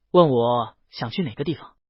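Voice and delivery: plain reading voice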